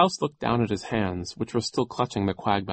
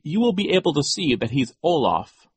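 louder, higher insistence; impatient; 7 semitones higher, 5 dB louder than usual. A narrator's reading. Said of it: "Violet said impatiently".

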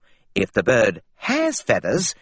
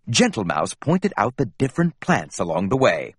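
plain reading voice

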